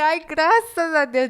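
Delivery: Ai, graças a Deus, stretched slowly in happy relief; joyful relief. Retold or imitated. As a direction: stretch out the thanks while jumping for joy. Jumping with friend in joy.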